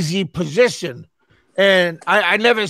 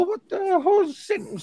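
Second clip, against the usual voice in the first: incredulous; 11 semitones higher, 5 dB quieter than usual. Retold or imitated. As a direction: balk at an outrageous demand. Retold shock at money demand.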